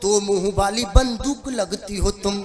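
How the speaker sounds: measured, rhythmic stage poetry; verse recitation. Acted. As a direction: lay out the verse for the audience. Recites his comic verse. (लयदार कविता पाठ)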